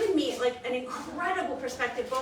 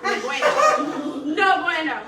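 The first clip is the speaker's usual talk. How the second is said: slow, loud and high, laughing; 5 semitones higher, 9 dB louder, slower than usual. Real, not acted. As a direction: stretch out no bueno while laughing. Laughing self-mockery.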